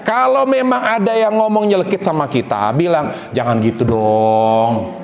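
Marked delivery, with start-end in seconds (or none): 3.3-4.9 slow, low, soothing plea; gentle reproach. Shown as a demonstration. Models a gentle reply to hurtful talk. (menegur lembut, pelan dan rendah)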